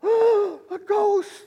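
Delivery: high-pitched, gasping cry of fright; gasp, then squeal in mock terror; terrified gasp. Acting frightened disciples seeing a ghost.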